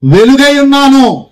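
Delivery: very slow, loud, every word spaced; loud slow proclamation. Shown as a demonstration. Preacher leads the crowd in declaring it loud and very slow. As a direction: declare it for all to echo. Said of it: "గట్టిగా చెప్పాలి".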